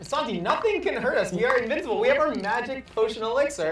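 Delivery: high, loud bravado; hyped up. Acted. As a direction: boast like a hero. Hyping invincibility.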